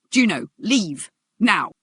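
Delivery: loud, slow, forceful order; urgent command. Acted. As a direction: drive him out with each word. She orders ex out.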